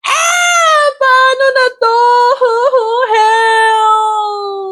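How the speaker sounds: high, loud hammed-up panic; mock panic. Host with no food for thirty guests, hammed-up panicked wail. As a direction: shriek it like the party is ruined.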